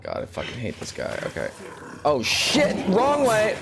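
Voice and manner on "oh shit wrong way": high frantic yell; panicked shout. Real, not acted. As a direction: shout in terror, running. Fleeing a monster.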